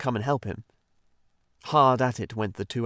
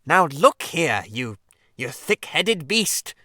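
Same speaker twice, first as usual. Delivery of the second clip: slow, louder, seething Rat; really angry; 5 semitones higher, 6 dB louder, slower than usual. Acted. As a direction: bite off the insult. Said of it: "replied the Rat, really angrily".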